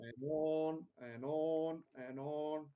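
slow, stretched-out repetition; long, level, endless-sounding list; drawn-out complaint. Real, not acted. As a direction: drag out each "on" wearily. Griping that it drags on.

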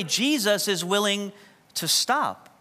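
ordinary talk

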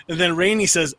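usual speaking voice

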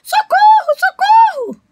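very high, loud cries for help; calling for help. A narrator's reading. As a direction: shout to the passing fish. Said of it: "pediu socorro".

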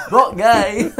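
laughing through the words, high and loud; real laughter. Real, not acted. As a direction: giggle it out.

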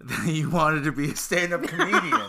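laughing while teasing. Real, not acted. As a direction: talk through giggles.

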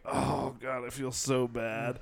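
slow, drawn-out mock misery; fake sick. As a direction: groan it out like a badly faked sick call. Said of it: "try to fake sounding sick".

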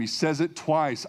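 normal talking voice

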